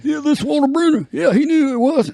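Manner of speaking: put-on squeaky old-timer voice; Walter Brennan impression. Host switches back to the old actor, high old-timer. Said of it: "I would change to Walter".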